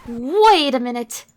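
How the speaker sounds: loud, drawn-out disbelief; sudden realization. Acted. Heroine catching herself mid-thought, startled. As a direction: stop short and draw it out loudly.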